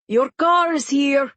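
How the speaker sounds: loud mother's call. Acted. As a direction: call to him from another room. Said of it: "his mother called".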